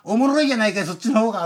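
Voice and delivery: a cheerful Kansai retort, half put on; mock Kansai dialect. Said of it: "柔らかい関西弁で".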